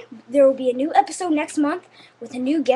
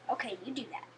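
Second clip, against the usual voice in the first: usual speaking voice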